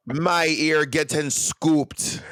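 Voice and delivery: slow, light Jamaican accent. Shown as a demonstration. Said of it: "little Jamaica accent".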